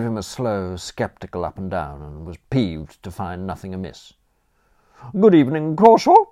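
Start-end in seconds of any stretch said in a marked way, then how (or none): 5.2-6.3 high, loud grudging greeting; grudging concession. A narrator's reading. Aunt grudgingly greeting the new butler, high and loud. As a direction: concede the greeting through your teeth. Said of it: "she conceded".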